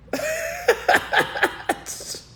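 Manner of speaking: high, wheezy burst of laughing; mocking laughter. Real, not acted. Streamer laughing at majority folks claiming minority, high cackle. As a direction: crack up at the absurdity.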